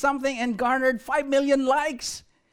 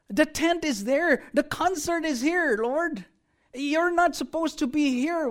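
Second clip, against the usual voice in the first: higher, urgent pleading Peter voice; excited Peter; 5 semitones higher than usual. Retold or imitated. Preacher voicing Peter urging Jesus back to the crowds, higher and eager. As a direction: hurry Jesus along, half exasperated.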